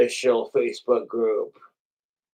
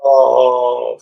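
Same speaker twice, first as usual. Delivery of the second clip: delighted oh; 9 dB louder than usual. Real, not acted. Sees the sliced prosciutto.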